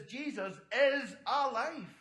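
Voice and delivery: loud, slow, each word of "is alive" weighted; emphatic proclamation. Real, not acted. Preacher announcing the resurrection message, emphatic "is alive". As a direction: proclaim it, hitting "is" and "alive" hard.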